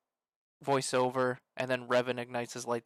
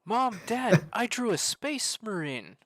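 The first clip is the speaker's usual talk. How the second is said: mock child announcing a drawing; excited child; 8 semitones higher, slower than usual. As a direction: play a proud kid, drawing out the last words. Acting child telling parents in skit.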